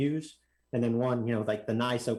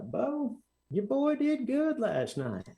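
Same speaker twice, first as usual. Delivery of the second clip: put-on old track owner; 11 semitones higher, much slower than usual. Older track owner greets dad.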